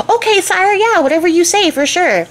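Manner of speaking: high, placating yes-sir voice; humoring the king. Retold or imitated. Townsfolk placating their odd king, high and humoring. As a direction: go along with him, eyes rolling.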